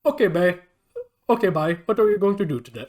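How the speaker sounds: light Indian accent imitation, quick at the end; imitating a film character's Indian accent. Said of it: "he talks like that".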